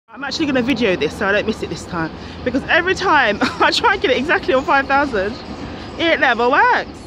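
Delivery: eager, laughing burst; excited. Real, not acted. Watching step counter hit five thousand.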